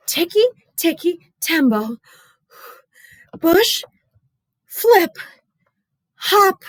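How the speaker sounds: high broken-up panting words; gasping, halting. Acted. Exhausted boy telling the painter, halting and gasping. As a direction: gasp each word between breaths. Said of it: "Chen stopped and started to cry".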